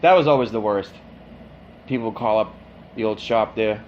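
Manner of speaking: ordinary delivery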